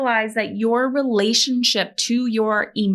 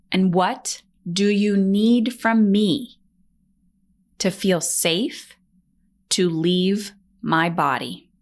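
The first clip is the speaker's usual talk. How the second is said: slow calm asking; slower than usual. Shown as a demonstration. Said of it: "So, example, anger".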